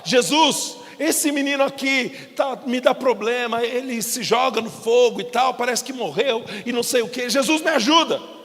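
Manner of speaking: loud, high pleading father; desperate plea. Acted. Father of the sick boy crying out to Jesus, loud and pleading.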